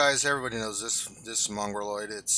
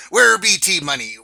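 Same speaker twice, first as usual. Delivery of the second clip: loud, higher pirate call; 5 semitones higher, 11 dB louder than usual. Pretend. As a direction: shout it like a pirate. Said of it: "in pirate voices".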